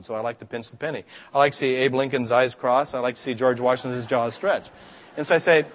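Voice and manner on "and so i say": ordinary talk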